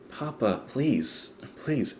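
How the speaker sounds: a hushed, fragile plea; barely above a breath, begging; very soft. Shown as a demonstration. Describing the opera's opening plea, sung very softly. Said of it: "it's just very soft".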